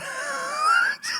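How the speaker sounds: high shouted burst of laughter. Real, not acted. Big laugh at own joke.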